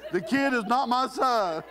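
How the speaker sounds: high, drawn-out pop star imitation. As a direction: mimic the singer's high lyric, slow and playful. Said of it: "What's that [name] said?".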